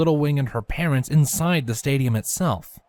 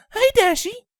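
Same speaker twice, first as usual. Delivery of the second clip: high mother voice; 4 dB louder than usual. Acted. Windy voiced.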